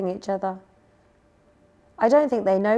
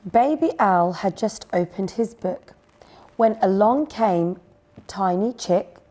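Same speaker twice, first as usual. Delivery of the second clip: usual speaking voice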